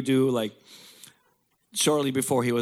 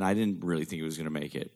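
usual speaking voice

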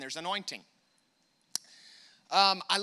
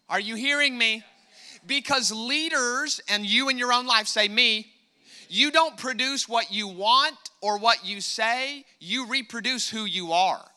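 louder, higher preaching push; 4 semitones higher, 7 dB louder than usual. Acted. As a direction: drive it home to the congregation. Preaching at full force.

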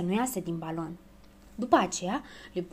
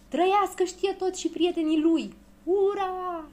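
high-pitched rousing cheer; cheering shout; 10 semitones higher, 3 dB louder than usual. A narrator's reading. Crowd hails the balloon travellers, cheering shout. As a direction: hail the heroes, ending on a long hurrah. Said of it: "strigară piticii".

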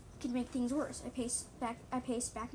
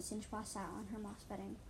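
plain reading voice

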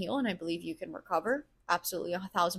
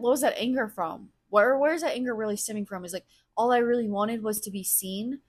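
usual speaking voice

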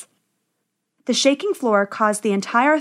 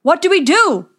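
high, loud, helpless wail; loud, high-pitched alarmed question; distressed cry; 7 semitones higher, 6 dB louder than usual. A narrator's reading. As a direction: cry out in panic as the dwarves. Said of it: "they cried".

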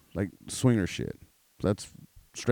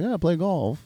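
mock old guy; 9 semitones higher than usual. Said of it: "this motherfucker's like".

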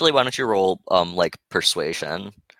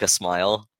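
ordinary talk

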